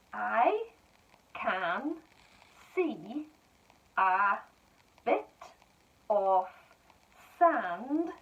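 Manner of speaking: each word set apart and clear; slow dictation. Shown as a demonstration. Teacher dictating to pupils, slow and clear. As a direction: dictate slowly to young writers. Rereading all sentences.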